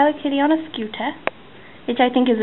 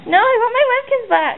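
whiny plea; 12 semitones higher than usual. Pretend. Someone takes her Webkins.

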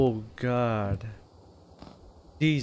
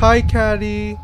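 nervous greeting; 8 semitones higher, 11 dB louder, slower than usual. Real, not acted. Scared player greets character.

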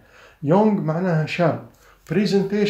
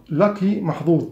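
ordinary talk, nothing marked

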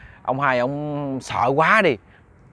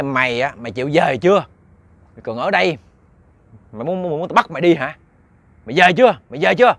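acted angry ghost, getting louder; threatening demand; 3 semitones higher, 4 dB louder, faster than usual. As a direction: menace him into going home. Ghost threatens man in dream.

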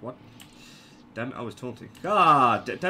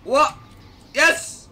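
high, loud burst of disbelief; surprised outburst; 13 semitones higher, 8 dB louder, faster than usual. Real, not acted. Gamer reacting to a sudden turn in play, surprised and loud. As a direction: exclaim it loudly, pitched way up.

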